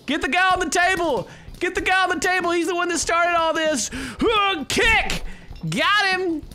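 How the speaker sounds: hammed-up high shouted NPC voice; voicing NPC. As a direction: yell orders at the crowd like a rowdy barfly. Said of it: "he's like".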